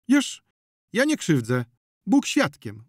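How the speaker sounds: loud, high self-defence; indignant protest. A narrator's reading. Zonia protests her innocence.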